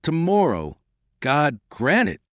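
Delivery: high-pitched, fervent plea; imploring. A narrator's reading. Nanan prays for the exchange tomorrow, imploring. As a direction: plead it slowly, like a prayer. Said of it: "with a lovely imploring expression".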